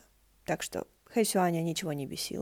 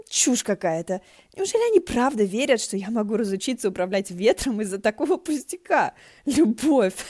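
indignant; 2 semitones higher, 6 dB louder than usual. Acted. Indignant rant at the play.

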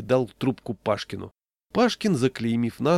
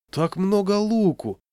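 high, loud, drawn-out amazement; surprised; 10 semitones higher, 6 dB louder than usual. A narrator's reading. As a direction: marvel like a child at the amount. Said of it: "удивился подошедший Кузьма". (удивлённо, высоким детским тоном)

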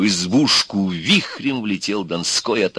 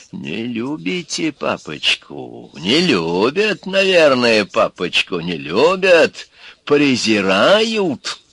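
тянуть слова, с высокими всплесками; медленный, протяжный голос обиженного змея-папочки; издевательски обиженный папочка; 6 semitones higher, 4 dB louder, much slower than usual. A narrator's reading. Горыныч паясничает перед жертвами. (притворно обиженно, нараспев)

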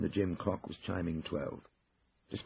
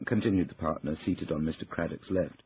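usual speaking voice

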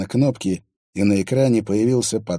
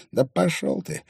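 usual speaking voice

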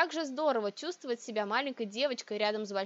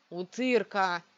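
growling; 2 semitones lower, 5 dB louder, slower than usual. A narrator's reading. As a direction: growl it out, rough and loud. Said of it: "прорычал Шенге".

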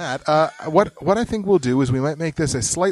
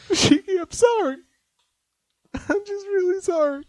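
much higher, whiny mock apology; high pleading voice.

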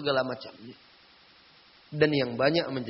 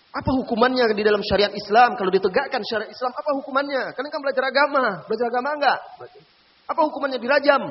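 higher, louder pressing questions; stern quizzing; 9 semitones higher, 6 dB louder than usual. Retold or imitated. Ustaz quizzing the girl about punishment, loud and pressing. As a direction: drill them on the law.

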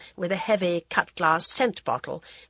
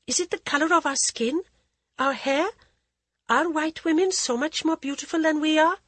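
put-on higher, anxious young bride; timid and pleading young woman; young wife voice; 10 semitones higher than usual. Jaya asks anxiously.

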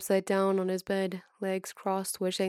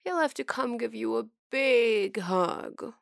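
whiny voice; 3 semitones higher, slower than usual. Acted. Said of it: "teases in a high, whiny voice".

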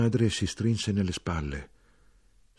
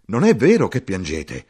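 much louder, urgent demand; shout; 2 semitones higher, 9 dB louder than usual. Acted. Narrator challenging the soldier, loud shout. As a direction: push it out hard at him.

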